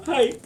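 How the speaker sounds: high-pitched hammed-up wailing; mock crying. Poet impersonates the hate-filled crowd starting to cry, mock sobbing. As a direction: fake a sobbing fit way up high. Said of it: "they start to cry".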